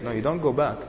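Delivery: ordinary talk, nothing marked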